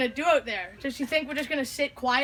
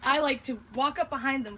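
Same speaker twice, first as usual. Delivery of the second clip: usual speaking voice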